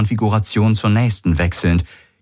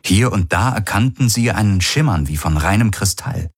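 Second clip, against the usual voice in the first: plain reading voice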